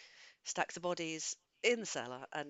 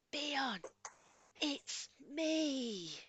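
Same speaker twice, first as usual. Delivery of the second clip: stage whisper; 9 semitones higher, much slower than usual. Acted. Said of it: "a stage whisper so that the other guard doesn't hear me".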